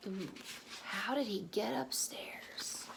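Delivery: slow hushed question; whispered aside. Shown as a demonstration. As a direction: whisper it to the child. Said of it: "whispered aside".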